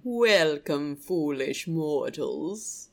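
deep, slow spooky ghost host voice; slow theatrical villain menace. Acted. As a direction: drawl it out like a haunted house host. Haunted Mansion ghost host greeting.

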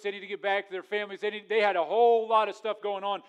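loud, driving sermon delivery; preaching at full force. Acted. Preacher rousing the congregation, loud and driving. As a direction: build the point and push it hard.

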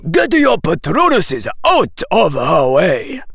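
forceful officer's command, high and loud; military command shout. A narrator's reading. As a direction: shout the order with full authority. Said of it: "in a voice of military command".

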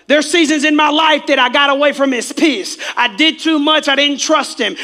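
high, loud, driving preacher's shout; preaching at full force. Real, not acted. Preacher confronting the congregation about their anger, full-force preaching. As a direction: hammer the congregation with each accusation.

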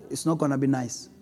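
ordinary delivery

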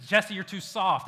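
a tough trainer calling you out; drill instructor. Retold or imitated. Navy seal drilling Jesse.

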